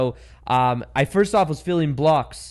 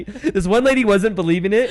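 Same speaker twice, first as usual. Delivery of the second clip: normal talking voice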